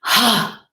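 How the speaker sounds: a single loud, high ha from the chest; loud release ha. Shown as a demonstration. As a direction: throw the ha out of your chest. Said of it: "Take the energy that's in your chest and go".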